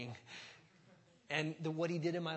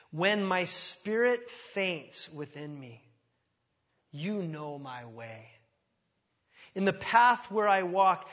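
slow, louder lament read; dramatic scripture reading; 3 semitones higher, 8 dB louder, slower than usual. Acted. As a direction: pour out the psalm's lament. Reads psalm dramatically.

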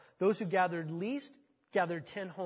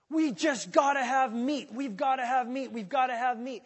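demanding Israelites; 6 semitones higher, 8 dB louder than usual. Retold or imitated. Israelites demanding meat, loud insistent chant. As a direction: pound out the demand.

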